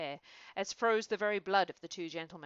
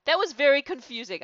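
laughing, high and loud; half-laughing at the false start; amused; 8 semitones higher, 13 dB louder than usual. Real, not acted. Reader laughing at her own false start, amused. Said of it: "'cause we jumped right in".

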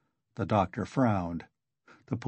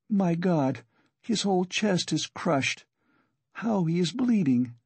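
higher-pitched, understated despair; 7 semitones higher than usual. A narrator's reading. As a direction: lament it in a raised pitch, without shouting. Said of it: "she said in despair".

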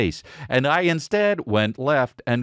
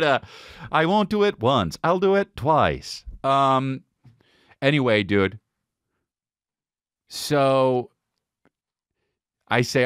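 ordinary talk, nothing marked

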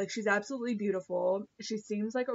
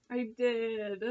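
sheepish confession through a laugh; laughing admission. Real, not acted. Laughing at own crying.

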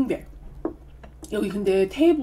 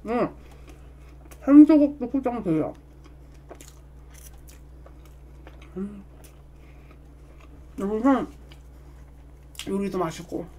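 chewing while talking; mouth full of cake; 3 dB quieter than usual. Real, not acted. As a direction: between bites of cake. Seen 3: Eats cake throughout; fork to mouth mid-phrase, cheeks working.

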